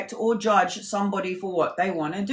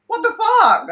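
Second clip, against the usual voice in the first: shot up high, louder, stunned; disbelief; 14 semitones higher, 5 dB louder than usual. Retold or imitated. Family reacting to mum's remark, high stunned outburst. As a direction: cry out in shock. All looking up at the sky going.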